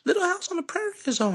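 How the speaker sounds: very high excited call-out; retold yell. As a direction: call the girls out to the backyard, very high. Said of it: "yell out in Creole".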